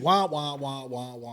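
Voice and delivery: a light mock of muffled cartoon-adult wah-wah; Charlie Brown teacher. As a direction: mimic garbled grown-up talk. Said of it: "what was that cartoon where you can't understand".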